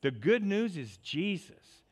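ゆっくり高く力強く「The good news is Jesus」; 会衆に向けて一語ずつ強く; emphatic preaching. Real, not acted. Repeated louder, slower, higher.

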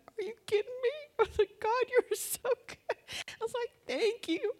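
very high, squeaky mock-tearful voice; crying with gratitude. Shopper thanking God for fifty off, imitated tearful squeak. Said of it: "and I'm crying".

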